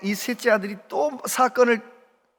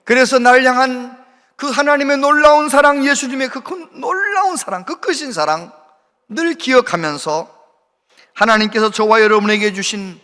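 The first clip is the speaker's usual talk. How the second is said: louder, ringing sermon close; fervent preaching; 3 semitones higher, 6 dB louder than usual. Acted. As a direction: preach it out to the whole congregation. Preaching climax. (설교 끝부분을 힘차게 외치는 목소리)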